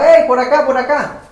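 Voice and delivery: loud, high call to friends; shouting. Acted. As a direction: holler to wave them over. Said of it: "Y gritaba".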